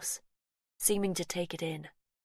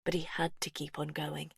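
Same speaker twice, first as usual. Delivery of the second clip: plain reading voice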